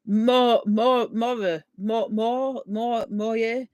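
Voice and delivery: halting, stumbling starts on Mo- before Moye; struggling pronunciation. Shown as a demonstration. Attempting a viewer's hard Welsh surname, halting and stumbling. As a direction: stammer over Mo- and give up at Moye. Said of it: "Try the surname".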